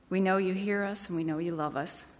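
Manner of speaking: normal talking voice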